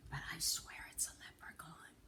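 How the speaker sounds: hushed whisper; awed whisper in the dark. A narrator's reading. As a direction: whisper so the leprechaun won't hear. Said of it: "Jamie whispered".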